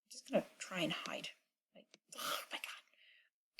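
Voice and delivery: very soft, breath-only whisper; hushed whisper. Real, not acted. Gamer hiding from a scary character, whispering.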